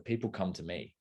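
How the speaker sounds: ordinary talk, nothing marked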